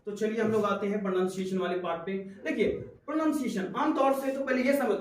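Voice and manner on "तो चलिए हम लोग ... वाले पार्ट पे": ordinary talk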